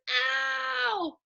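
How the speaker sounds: high yelped Ow!; ouch. Acted. Teacher acting out the sound O W makes, as a high yelp. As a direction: yelp as if hurt. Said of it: "O W says".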